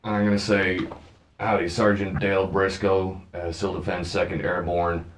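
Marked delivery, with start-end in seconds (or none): 1.4-5.0 low, controlled official voice; brief the ushers as a sergeant, low and contained; understated, authoritative officer; military sergeant. Acted. Player poses as military sergeant.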